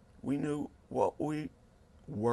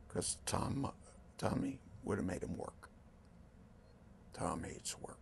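whispered, confiding gossip; hushed aside; 4 semitones lower, 11 dB quieter than usual. Real, not acted. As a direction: drop to a whisper as if sharing a secret. Gossipy aside about Tom.

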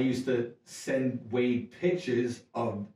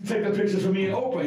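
calling out; 9 semitones higher than usual. Retold or imitated. To James Woods across the party, shouting for a photo. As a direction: shout over to get the photo taken.

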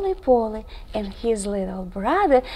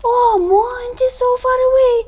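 crying for help; 9 semitones higher than usual. Acted. Stranded Monty cries again to his brother, high and pleading. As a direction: cry out from far across the ice. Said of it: "cried Monty again".

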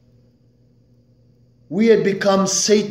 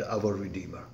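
"our Redeemer" dropped much softer and lower; hushed refrain; 8 semitones lower, 13 dB quieter than usual. Acted. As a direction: let "Redeemer" sink to a reverent hush. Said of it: "You know why I emphasize the word Redeemer?".

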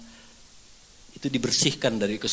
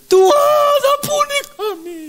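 very high, loud imitated cry to God; hammed-up repentant scream; screaming plea; 9 dB louder, slower than usual. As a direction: wail out for mercy, stretching the first word. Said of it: "Mereka teriak".

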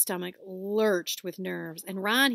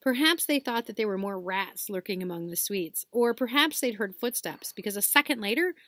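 ordinary delivery

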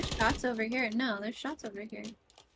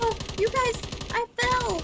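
loud and high, alarmed; high panicked yelp; 13 semitones higher, 5 dB louder than usual. Real, not acted. Player falls in game.

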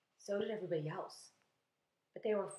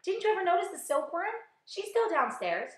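high, loud, excited question; loud, animated grasshopper; crying out; 10 semitones higher, 6 dB louder than usual. A narrator's reading. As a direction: exclaim with eager surprise. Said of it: "cried the old green grasshopper".

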